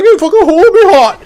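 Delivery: loud, very high Newcastle-Cockney mash; hammed-up bad British accent; terrible British accent. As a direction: shout it in mangled British. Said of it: "terrible British accent".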